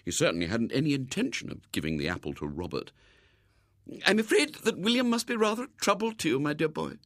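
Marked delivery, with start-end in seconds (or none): 4.0-7.0 high-pitched, kindly lady; sympathetic lady. A narrator's reading. Lady sympathises with the boy over William, kindly. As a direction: commiserate in a high, ladylike voice.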